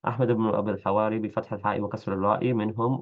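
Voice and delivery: ordinary talk, nothing marked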